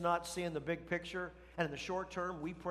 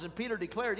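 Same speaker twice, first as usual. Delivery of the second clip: normal talking voice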